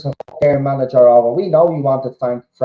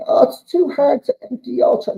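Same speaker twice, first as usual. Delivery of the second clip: high, whiny mock excuse; much higher whining imitation of fans making excuses; 12 semitones higher than usual. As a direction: whine it like a lazy fan. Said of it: "but you kept saying".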